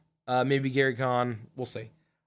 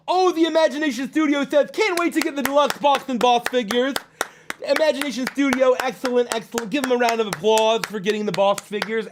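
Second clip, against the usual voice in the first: high, lisping cartoon showman; theatrical cheer in a zany Ed Wynn-style voice; Chuckles, excited; 7 semitones higher, 4 dB louder than usual. Host hailing a backer to the chat, excited Chuckles voice.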